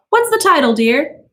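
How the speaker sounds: high brittle merriment; giddy wife. A narrator's reading. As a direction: play the moment off as a joke. Mildred voiced.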